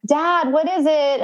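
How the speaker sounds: retold expectant announcement call; prompting doctor. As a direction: imitate a doctor cueing the new dad, slowly. Doctor prompts dad at birth.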